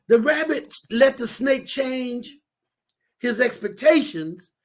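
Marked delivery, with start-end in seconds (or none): none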